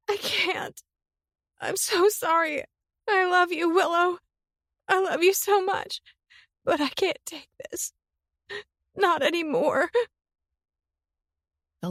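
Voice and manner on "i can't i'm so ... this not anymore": high, tear-choked farewell; tearful goodbye. Acted. Summer saying a tearful goodbye to Willow. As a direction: say goodbye through tears.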